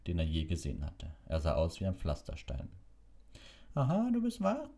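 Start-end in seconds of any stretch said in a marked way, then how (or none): 3.7-4.7 high-pitched, brisk matron's greeting. A narrator's reading. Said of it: "begrüßte ihn forsch". (forsche Begrüßung in hoher Stimme)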